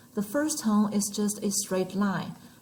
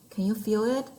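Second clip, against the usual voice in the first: ordinary talk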